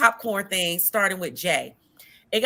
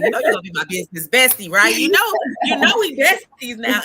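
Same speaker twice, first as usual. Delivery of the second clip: excited gushing; 7 dB louder than usual. Retold or imitated. Host imitating herself after a call with a new bestie, excited gushing. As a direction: gush it out like a thrilled new best friend.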